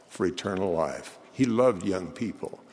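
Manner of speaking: ordinary talk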